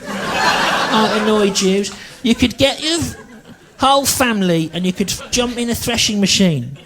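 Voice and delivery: put-on high, strained, ranting old bigot; mock ranting bigot. Comic mocking a bigoted nan, high ranting voice. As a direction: whine it like a paranoid Daily Mail reader.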